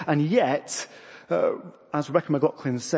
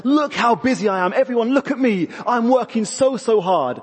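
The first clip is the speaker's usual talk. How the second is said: high, loud, showy martyr; puffing herself up; 8 semitones higher, 4 dB louder than usual. Acted. Martha demanding everyone notice her work, self-important mock boast. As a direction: crow about being overworked, hammed up. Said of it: "She's puffing herself up. She's saying".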